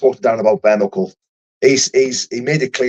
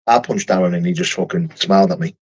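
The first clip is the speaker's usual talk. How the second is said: usual speaking voice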